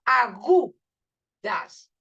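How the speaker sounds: "agudas" with GU punched and drawn out, then a gap before "das"; slow "agudas", weight on the U; stressed syllable. Shown as a demonstration. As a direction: break "agudas" after a strong GU. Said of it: "el fonema que se acentúa".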